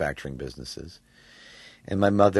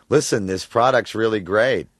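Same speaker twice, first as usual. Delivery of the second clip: sarcastic; 6 semitones higher, 10 dB louder than usual. Retold or imitated. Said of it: "sound sarcastic when I read commercial copy".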